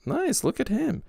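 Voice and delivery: very high-pitched approving quote; admiring reaction. Retold or imitated. Host recalling seeing Doug's view count, high admiring voice. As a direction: coo it way up high. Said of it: "and I was like".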